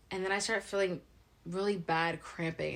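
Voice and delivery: ordinary talk, nothing marked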